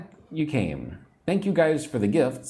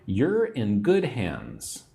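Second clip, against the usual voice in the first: slow teaching read; slower than usual. Shown as a demonstration. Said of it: "This means you are in good hands".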